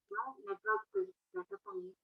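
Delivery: faint, hard-to-hear voice; too quiet to make out; barely audible. Real, not acted. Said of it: "Your voice is very low".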